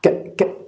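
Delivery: "ك-، ك-،" as a breathy k sound with no vowel; just puff out "ك-، ك-،" with no voice; isolated k sound. Shown as a demonstration. Teacher showing students the bare k sound in "ك-، ك-،". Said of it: "يبقى إذن صوت الكي".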